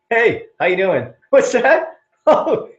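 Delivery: high, delighted mock phone chat; put-on cheery surprise, laughing between phrases; pretend phone call. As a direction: act thrilled by the caller, pitched high. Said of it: "Like you got a phone call".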